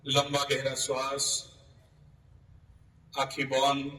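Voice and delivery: low-key, even meditation voice with long pauses; guided yoga nidra. Real, not acted. Yoga teacher guiding students to relax from the feet up, guided meditation. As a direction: guide them through the body, unhurried.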